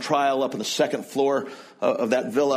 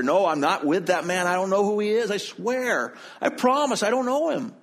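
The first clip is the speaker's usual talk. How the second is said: climbing higher and more desperate; rising panicked denial; 6 semitones higher than usual. Acted. Peter by the fire, swearing he never knew Jesus, frantic. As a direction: plead harder with each line.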